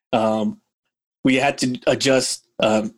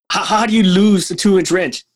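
higher, indignant question; venting about a coworker who lost a wrench, indignant; indignant disbelief; 6 semitones higher than usual. Real, not acted. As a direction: ask it up high, incredulous.

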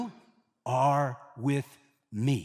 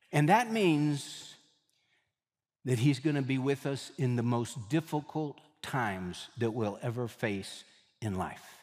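ordinary delivery

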